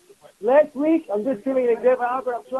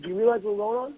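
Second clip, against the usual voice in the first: ordinary talk, nothing marked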